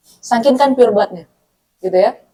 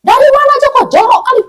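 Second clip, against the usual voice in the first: much higher, louder nagging mother; scolding mum; 14 semitones higher, 6 dB louder than usual. Retold or imitated. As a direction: rattle off a mum's scolding at a dirty child. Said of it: "emak kan langsung merepet".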